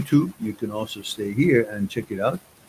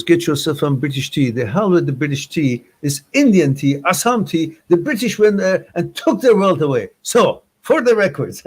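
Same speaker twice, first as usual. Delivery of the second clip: playful outrage at the British, louder and higher; half-joking louder tirade; mock indignant rant; 6 semitones higher, 5 dB louder than usual. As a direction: huff and puff in fake protest over tea. Mock rant about British tea.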